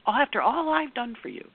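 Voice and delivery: high, put-upon guilt trip, hammed up; mock martyred whine. Partner guilt-tripping about everything done, in a hammed-up whine. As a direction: pile on the self-pity.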